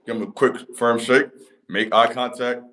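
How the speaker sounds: usual speaking voice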